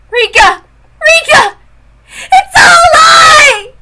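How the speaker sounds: high loud wailing pleas; sobbing pleas. Acted. Girl over her friend's body, wailing pleas. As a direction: beg her dead friend to wake up.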